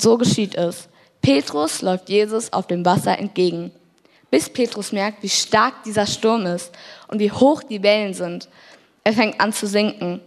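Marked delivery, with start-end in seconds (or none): none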